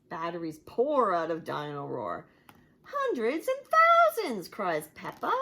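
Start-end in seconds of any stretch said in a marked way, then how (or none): 2.8-4.5 high, loud delighted shout; excited cry. Acted. Peppa cries out at the pile of batteries, high and loud. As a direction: exclaim in glee.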